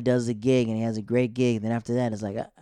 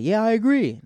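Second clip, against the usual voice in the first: high, loud mocking yeah; mock agreeing crowd; 11 semitones higher, 6 dB louder than usual. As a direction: ham up everyone nodding along. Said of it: "And everybody's like".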